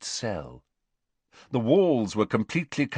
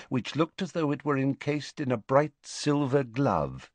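plain reading voice

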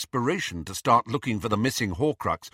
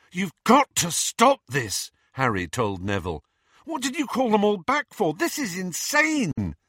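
high, frantic remonstrance; exasperated; 9 semitones higher, 3 dB louder than usual. Acted. Harry telling Neville to stop this, exasperated. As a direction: protest in exasperation, drawing out the key words.

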